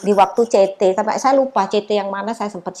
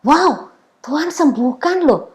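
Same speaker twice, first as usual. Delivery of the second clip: slow, awed exclamation of wonder; overjoyed slow gasp of praise; amazed joy; 6 semitones higher, 3 dB louder, slower than usual. Retold or imitated. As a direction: let the wonder well up as you say it. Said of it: "ada sesuatu yang ngelonjak dalam hatiku".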